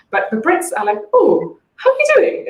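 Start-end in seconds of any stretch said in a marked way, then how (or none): 1.1-2.5 much higher and slower, a swooping British melody; exaggerated British singsong. German guest imitating a Brit's greeting, exaggerated British singsong. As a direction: overplay the lilting British greeting. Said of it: "it goes up and down a lot".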